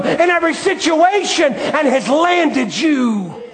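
high, loud, building sermon climax; a preacher rousing the congregation to trust Jesus; full-force preaching. Acted.

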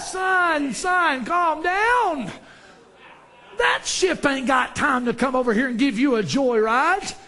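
high-pitched, insistent scolding; exasperated old man. Acted. Old man telling the hollering boy to calm down, exasperated. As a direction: cut in over the boy's hollering.

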